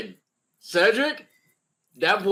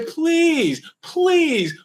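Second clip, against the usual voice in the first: high-pitched mock plea, each word falling; begging; 9 semitones higher than usual. Co-host voicing a team begging Kyrie, mock begging. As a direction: plead it in a drawn, high voice. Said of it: "They literally are begging you to say".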